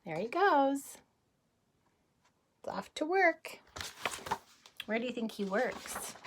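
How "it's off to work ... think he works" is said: usual speaking voice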